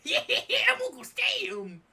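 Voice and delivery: loud, high, slow cartoon Uncle Sam; hammed-up Uncle Sam bellow. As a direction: boom it out like a goofy patriot. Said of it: "that's what his voice is now".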